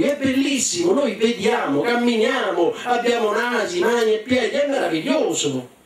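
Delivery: elenco gioioso in tono molto acuto; entusiasmo. Acted. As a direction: ottimista che esulta a ogni parola. Joseph esulta davanti ai fratelli. (esultanza acuta e infervorata)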